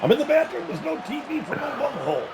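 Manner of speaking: very high cartoon whine; Beavis. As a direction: squeal it far above your own voice.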